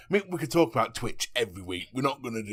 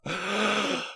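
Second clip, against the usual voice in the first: high "oh" after a gasp; mock gasping reaction; 8 semitones higher than usual. Host imitating everyone's reaction, mock gasp. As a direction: act out the listeners' gasp. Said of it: "and everyone's like".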